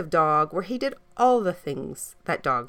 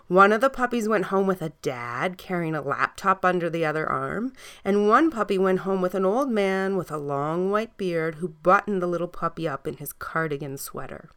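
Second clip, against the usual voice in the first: usual speaking voice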